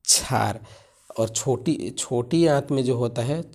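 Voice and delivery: ordinary talk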